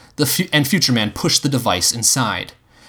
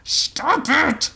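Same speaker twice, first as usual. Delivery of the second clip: high, strained cry; high-pitched yelp of a command. A narrator's reading. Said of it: "Future Man cried".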